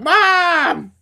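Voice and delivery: loud, high call across the house; urgent shout for help; calling for mom. Retold or imitated. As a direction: yell for mom in a panic. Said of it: "I'm like".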